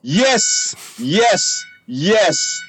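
high, bright yes repeated; excited cheering. Real, not acted. Celebrating getting the podcast started.

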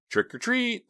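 sudden high yell. A narrator's reading. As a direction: jump up and yell it. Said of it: "the skeleton yelled".

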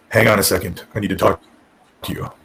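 low monotone phone manner; Tommy Dreamer. Retold or imitated. Boss holding a caller on the line, monotone. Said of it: "This super monotone voice".

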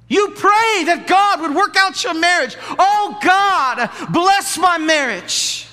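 high, loud, slow prayer; mock pleading prayer. Pastor mimicking a spouse praying for their marriage, hammed-up prayer. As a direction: plead to God dramatically.

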